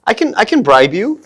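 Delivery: high-pitched, coaxing American voice; high, friendly American. Acted. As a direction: tempt the dog with a high, friendly lilt. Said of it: "my pitch is also higher".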